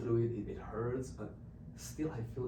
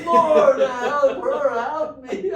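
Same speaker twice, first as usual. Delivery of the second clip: panicked prayer; 13 semitones higher, 17 dB louder, much slower than usual. Retold or imitated.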